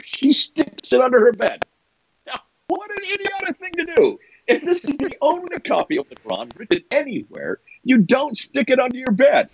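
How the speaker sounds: high-pitched, exasperated disbelief; incredulous exasperation. Real, not acted. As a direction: exclaim at how absurd it is. Mocks a foolish decision, incredulous.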